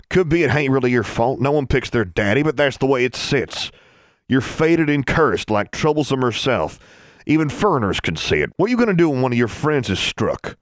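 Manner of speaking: high, heated country tirade; relentless accusation. A narrator's reading. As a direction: press the charge harder with each line.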